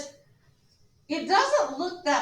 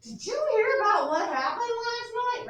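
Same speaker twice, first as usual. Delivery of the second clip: much higher, louder eager gossip opener; hallway gossip; 10 semitones higher, 5 dB louder than usual. Retold or imitated. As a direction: lean in to share juicy news. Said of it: "And then it's like".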